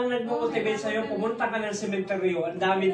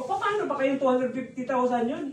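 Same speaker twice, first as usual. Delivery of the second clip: normal talking voice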